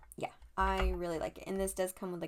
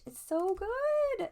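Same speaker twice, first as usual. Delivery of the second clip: excited whisper, much higher, slow; gushing whisper; 13 semitones higher, 5 dB louder, slower than usual. Real, not acted. Gushing over the tarot card in a high, slow excited whisper. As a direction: breathe it out in awe.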